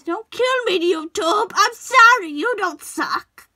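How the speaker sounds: loud, high mock pleading; over-the-top apologetic shout, last words drawn out; mock panicked apology. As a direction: yell a hammed-up plea for mercy.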